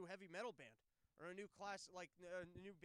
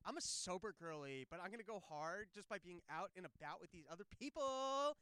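mock sober girly; 7 dB louder than usual. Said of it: "Am I gonna have to take a class where I get to talk?".

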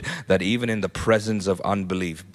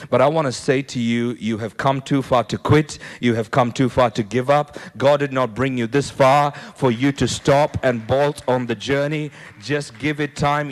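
louder, insistent exhortation, urging them not to quit; fervent preaching; 2 semitones higher, 5 dB louder than usual. Acted. Pastor urging a weary congregation, fervent and louder. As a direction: press it home like a rallying call. Preaching encouragement at full force.

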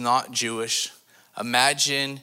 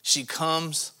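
usual speaking voice

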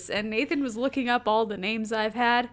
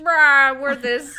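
mock proud cheer; 4 semitones higher, 9 dB louder, slower than usual. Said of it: "and we're like".

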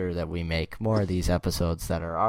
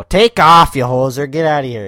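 loud, high Canadian hoser shout; mock-angry shout in Canadian accent; hoser yell; 6 semitones higher, 14 dB louder than usual. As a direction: yell to send someone packing. Acted yell at cousin.

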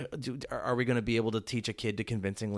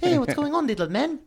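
high, loud mock New Zealand accent; over-the-top high Kiwi impression; 6 dB louder than usual. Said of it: "His New Zealand accent was still very strong".

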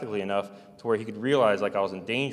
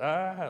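retold scream of shock; 8 semitones higher than usual. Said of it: "And I yelled".

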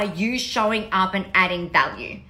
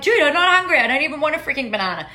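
annoyed refusal; 6 semitones higher, much faster than usual. Retold or imitated. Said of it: "and they're like".

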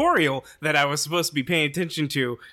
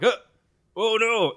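higher, louder mock panicked cry; mock alarm; 10 semitones higher, 6 dB louder than usual. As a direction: imitate startled enemies crying out. Retold enemies startled by the shot.

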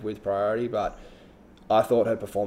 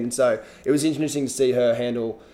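ordinary delivery

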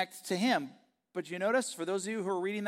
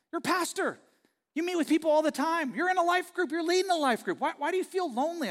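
lightly voiced wife, much higher; imitating his wife; 11 semitones higher, 5 dB louder than usual. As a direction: play the puzzled wife in a raised voice. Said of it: "She'd be like".

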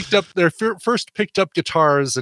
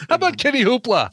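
laughing; 13 semitones higher, faster than usual. Real, not acted. Laughing after a fumble.

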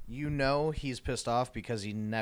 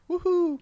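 ironic woohoo; 8 dB louder than usual. Real, not acted. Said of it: "I'm not the".

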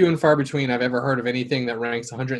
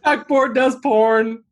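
laughing; 8 semitones higher, much slower than usual. Real, not acted. Repeating the joke, cracking up.

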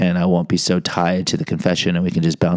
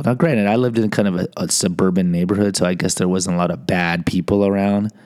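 usual speaking voice

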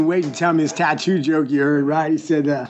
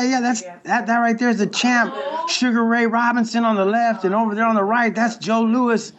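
higher, proud husband naming the boxers; joke husband voice; 6 semitones higher than usual. Retold or imitated. Grandpa's joke husband proudly naming the tattooed boxers, higher voice.

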